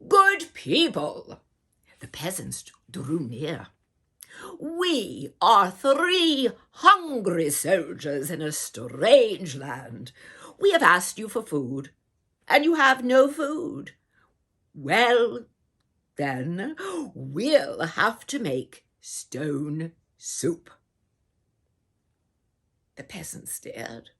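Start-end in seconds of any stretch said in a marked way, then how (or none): 0.1-1.4 loud, slow call to the crowd. A narrator's reading. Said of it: "called out". 4.6-20.7 louder, slow soldier voice announcing a plan; a lightly voiced soldier speaking up to the crowd; soldier's proposal. Soldier addresses the villagers.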